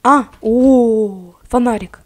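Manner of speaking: ordinary talk, nothing marked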